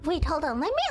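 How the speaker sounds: ordinary delivery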